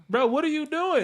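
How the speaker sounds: high-pitched baffled challenge; incredulous, pitched well up; retold disbelief. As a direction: play a surgeon stunned at his colleague. Acts surgeon baffled by colleague's fit.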